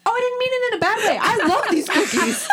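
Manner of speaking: flustered laughing apology. Real, not acted.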